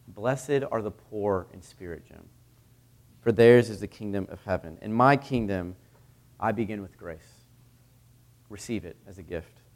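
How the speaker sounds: with grace and love. Retold or imitated. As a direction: bless him gently, like a kind teacher. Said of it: "with grace and sincerity and love".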